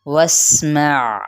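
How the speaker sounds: واسمع very slow, with a whistling sin and a clear ain; slow tajweed demo. Shown as a demonstration. Teacher models واسمع for students, whistled sin and distinct ain. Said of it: "سین پہ سیٹی اور عین کی بھی".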